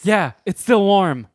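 high, loud and slow for shock; loud gross-out joke. Acted. Joking about a warm dog poop bag, loud and slow. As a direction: shout the gross detail to crack everyone up.

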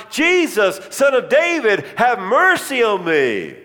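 high, loud desperate plea. Retold or imitated. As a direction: cry out over the crowd trying to silence him. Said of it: "They tried to throw me to the ground".

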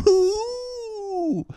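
very high falsetto ooh; a silly high-pitched squeal; squeaky frog ooh. Pretend. Pretending to be a frog after eating flies, falsetto squeal.

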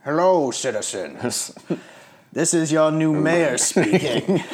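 over a PA system. Pretend. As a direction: announce it slowly like a crackly city speaker. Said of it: "a crackly loudspeaker voice".

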